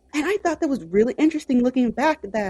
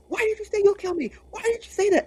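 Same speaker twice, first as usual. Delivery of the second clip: panicked demand; 11 semitones higher, faster than usual. Retold or imitated. Panicked wife shaking sleeping ex.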